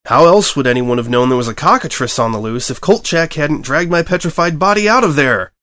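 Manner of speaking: a reporter near yelling in frustration; nearly shouting. Acted. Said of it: "Pass nearly shouted".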